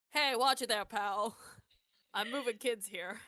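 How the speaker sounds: indignant animatronic character hollering at someone in the way; big, brash cartoon callout; Archie voice. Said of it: "doing an impression of Archie and just calls back".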